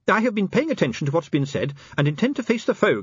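high-pitched, formal little official; prim inspector. Acted. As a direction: declare your resolve in a high, formal voice. Pessimal solemnly volunteers to fight.

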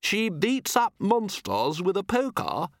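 high, slow marvelling guest; amused guest. Acted. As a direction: marvel at the governess.

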